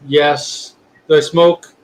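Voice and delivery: ordinary delivery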